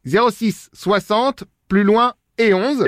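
very slow, syllable by syllable, as if writing it down; slow dictation. Acted. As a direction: spell out the number while noting it. Noting the number again.

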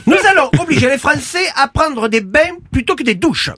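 lightly voiced Castex, southern lilt, official tone; a hint of the Prime Minister announcing measures; Jean Castex impression. Castex impression announcing absurd measures to the French, solemn. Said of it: "C'est Jean Castex".

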